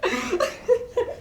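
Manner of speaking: soft, high mocking chuckle; scoffing laugh. Sceptic laughs at partners idealising resistance, softly mocking. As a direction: laugh lightly at their naivety.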